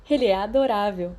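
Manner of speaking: flirty. A narrator's reading. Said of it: "batendo o cílio sedutoramente".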